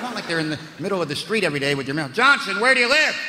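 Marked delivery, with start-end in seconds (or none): 2.1-3.2 mailman calling out. Acted.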